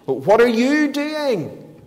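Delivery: high, drawn-out, incredulous question; Peter's startled outburst, stretched and high; blurted protest. Acted. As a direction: blurt it as a slow, high-pitched challenge. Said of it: "That's why Peter blurts out".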